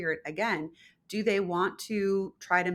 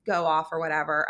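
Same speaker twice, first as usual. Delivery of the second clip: ordinary talk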